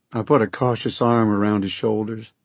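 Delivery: ordinary delivery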